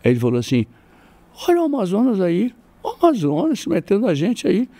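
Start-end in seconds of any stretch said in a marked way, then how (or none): 1.5-4.7 taunting bully. Retold or imitated.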